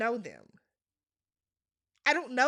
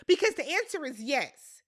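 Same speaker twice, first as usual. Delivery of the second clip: ordinary talk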